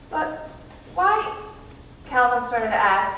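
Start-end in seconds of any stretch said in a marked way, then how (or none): 0.1-1.3 hoarse croak. A narrator's reading. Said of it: "his voice croaking hoarsely".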